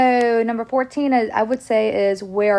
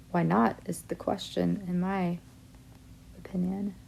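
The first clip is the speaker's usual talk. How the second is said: quiet aside; 10 dB quieter than usual. Real, not acted. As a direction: drop to a soft, half-whispered aside. Aside joke, voice drops to whisper.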